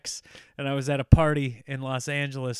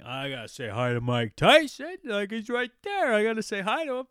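slurred, mushy tipsy insistence; slurring drunk, climbing higher as it goes; acted drunk; 7 semitones higher, 4 dB louder than usual. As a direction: talk yourself into it like a sloppy drunk. Retold drunk resolve to greet Tyson.

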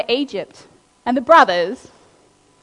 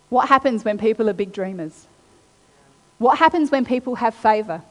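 ordinary delivery

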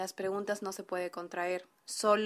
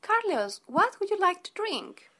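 higher, bright hostess offering drinks; role-play hostess; 9 semitones higher than usual. Acted. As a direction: play the polite host, pitched up.

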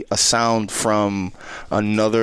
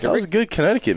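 very high silly voice; goofy high voice; 9 semitones higher, much faster than usual. Pretend. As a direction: squeak out the rating. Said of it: "repeats rating in high voice".

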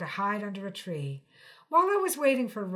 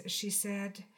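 ordinary delivery, nothing marked

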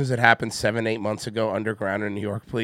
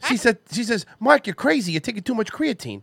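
higher Boston tough-guy voice, worried; a puzzled Boston guy in a tunnel; concerned Mark Wahlberg; 9 semitones higher than usual. Acted. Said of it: "impression of a concerned citizen Mark Wahlberg".

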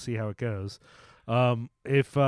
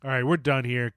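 ordinary delivery

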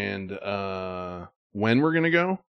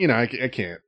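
ordinary talk, nothing marked

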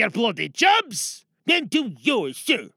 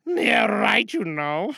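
usual speaking voice

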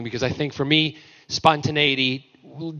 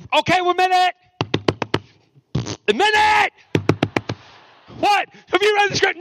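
high, fast, breathless gushing; hammed-up excited chatter, much higher; mock giddy excitement. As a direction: burst in bursting with news. Imitating David again, excited.

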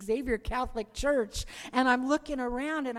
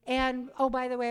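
ordinary talk

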